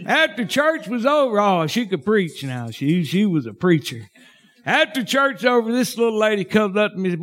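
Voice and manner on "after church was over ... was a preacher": ordinary talk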